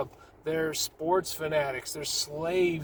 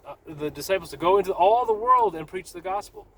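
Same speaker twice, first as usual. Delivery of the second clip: normal talking voice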